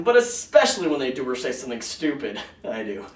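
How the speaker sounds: ordinary talk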